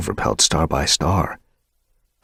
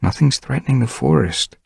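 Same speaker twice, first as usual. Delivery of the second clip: promise; 8 semitones higher than usual. Acted. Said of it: "Tigerheart promised".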